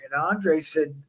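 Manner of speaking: usual speaking voice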